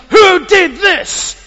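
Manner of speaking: loud, high, slow furious shout; angry crowd. Acted. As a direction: bellow it at the town. Townspeople angrily ask.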